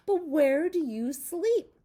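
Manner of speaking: high, slow, loud lamb; curious lamb, high and slow; little lamb voice. Acted. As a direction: ask it wide-eyed like a lamb. Lamb voiced in a read-aloud.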